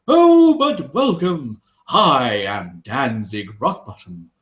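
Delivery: recovering into a grand, slow welcome; a hint of a puffed-up mayor; pompous dwarf greeting. Mayor greets the party, lightly voiced pompous dwarf. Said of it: "He goes".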